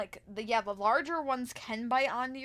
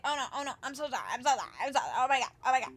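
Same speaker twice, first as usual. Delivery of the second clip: mock frantic apology; 2 semitones higher, 4 dB louder, much faster than usual. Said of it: "frantically apologizing".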